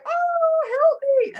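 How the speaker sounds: high, loud, hammed-up cry for help; mock distress call. One voicing needy signs calling out for help, high and loud. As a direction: wail it like a drowning soul. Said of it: "foghorns in distress".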